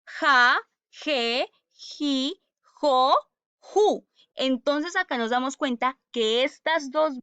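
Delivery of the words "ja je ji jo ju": "Ja, je, ji, jo, ju" with throaty Spanish j in each; teacher recites "Ja, je, ji, jo, ju" spaced out; J syllable drill. Shown as a demonstration. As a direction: model the j sound clearly in "Ja, je, ji, jo, ju".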